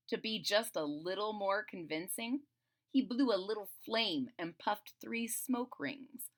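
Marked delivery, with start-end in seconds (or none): none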